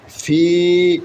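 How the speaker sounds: ফি with the long ee held; one-alif stretch. Shown as a demonstration. Teacher shows the madd rule, lengthening the vowel of ফি. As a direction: stretch ফি evenly for one beat. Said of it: "এক আলিফ টান হয়".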